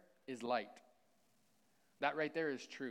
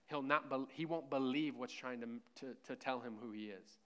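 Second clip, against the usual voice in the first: ordinary talk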